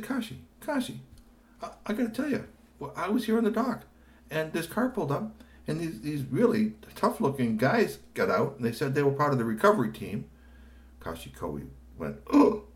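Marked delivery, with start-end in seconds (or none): 0.0-10.3 high, urgent phone report; high Tony voice. Acted. Tony phoning Kashi with news, urgent. 12.3-12.6 high loud gasp of surprise; startled oh. Acted. Kashi startled on the phone, surprised.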